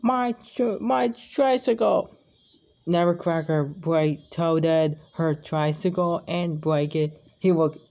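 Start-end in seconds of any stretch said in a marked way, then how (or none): 0.0-2.1 high, broken crying voice. Acted. Little girl crying over her broken tricycle. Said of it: "She's crying".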